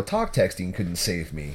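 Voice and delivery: ordinary talk, nothing marked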